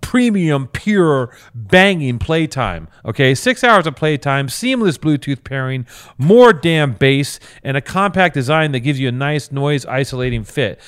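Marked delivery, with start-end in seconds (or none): 0.0-2.9 hyped ad read. Pretend. 6.2-7.4 loud bass boast. Pretend. Hyped ad read.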